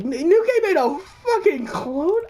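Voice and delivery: high, slow question broken by laughter; cracking up in mock shock; laughing disbelief. Hearing about the clone.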